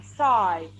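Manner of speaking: "sight" with a drawn-out eye vowel; low, lengthened "sight"; long I sound. Shown as a demonstration. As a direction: stretch the I in "sight", pitch falling low. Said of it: "long I sound only".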